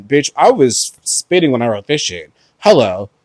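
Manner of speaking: ordinary delivery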